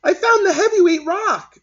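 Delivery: high, loud proud announcement; a kid bursting with news; proud child. A narrator's reading.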